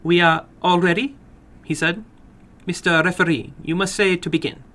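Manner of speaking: a little man running his game with relish. Acted. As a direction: play the little man, keen and formal.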